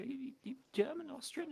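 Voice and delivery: high-pitched retold question; quoted guess in a high, questioning voice; imitated questioning. Retold chat with foreign seatmate.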